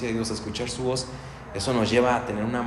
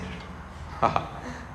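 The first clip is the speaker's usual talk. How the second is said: breathy laugh; 10 dB quieter than usual. Real, not acted. Moved by the story.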